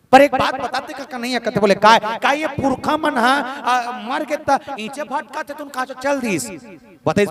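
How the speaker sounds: high, pressing questions; lifted, insistent storytelling voice; acted questioning. Acts out a questioner addressing Krishna.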